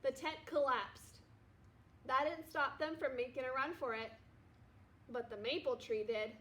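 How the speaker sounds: ordinary delivery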